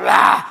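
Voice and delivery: short loud gruff roar; playful angry bark; mock growl. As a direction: growl like the grumpy mechanic. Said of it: "gruff friend's growl".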